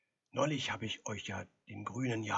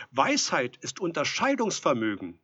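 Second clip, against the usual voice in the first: raised, higher voice of reproof; lecturing loudly; 5 semitones higher, 10 dB louder than usual. A narrator's reading. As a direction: lecture him through the door. Visitor lectures through the door.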